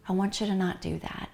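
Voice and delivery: usual speaking voice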